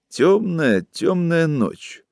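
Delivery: slow, drawn-out dreamy murmur. A narrator's reading. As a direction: say it slowly, eyes closed, half in a dream. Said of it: "продолжал Павел с закрытыми глазами".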